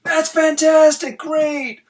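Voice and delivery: high, loud, gushing praise; hype. Retold or imitated. Friend gushing over a promise to quit, high and loud with excitement. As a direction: cheer the goal way too early. Friend hyping someone's goal.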